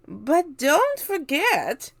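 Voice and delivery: high, loud, slow mocking aunt voice; a shrill-pitched spiteful aunt, stretched out slow; Aunt Spiker crying out. A narrator's reading. Aunt Spiker taunting her sister, high and loud. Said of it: "Aunt Spiker cried".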